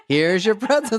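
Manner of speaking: mock waiter.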